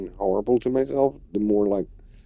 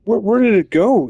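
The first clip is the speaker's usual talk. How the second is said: high, loud and frantic; alarmed searching; 10 semitones higher, 6 dB louder than usual. Real, not acted. Gamer hunting a deleted entry, high alarmed cry. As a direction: scramble to find it. Item vanished from game menu.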